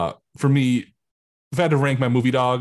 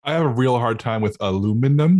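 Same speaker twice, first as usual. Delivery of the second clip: usual speaking voice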